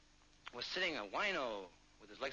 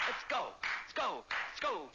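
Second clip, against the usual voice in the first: repeated high, punchy calls; excited rallying call; 7 semitones higher, 3 dB louder than usual. Acted. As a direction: urge everyone out the door. Gang heads out.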